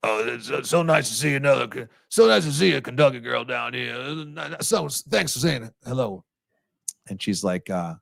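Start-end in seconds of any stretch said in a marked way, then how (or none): 0.0-6.2 lightly imitated Cajun coach; Coach O impression. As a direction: rasp out a southern Louisiana coach. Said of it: "he goes".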